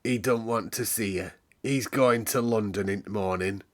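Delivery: plainly stating the master's wishes; lightly voiced old manservant. A narrator's reading. Mr Pitcher's voice, blunt.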